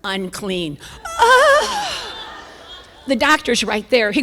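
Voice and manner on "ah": high piercing cry of dismay; shocked scream. Retold or imitated. Seeing the unclean chart, high shocked scream. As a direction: let out a sudden shocked shriek.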